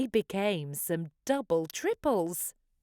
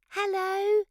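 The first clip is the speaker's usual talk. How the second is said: bright little dinosaur hello, much higher; high cheery call; 14 semitones higher, 6 dB louder than usual. A narrator's reading. Little Hugosaurus greeting new friends with a high call. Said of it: "she called and gave a wave".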